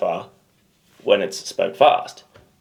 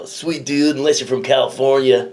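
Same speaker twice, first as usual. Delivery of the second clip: higher, hammed-up Californian dude; bad Californian accent; 4 semitones higher than usual. Host teasing friend about Americans, mock Californian accent. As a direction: do a badly faked California accent. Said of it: "Well, that was pretty bad".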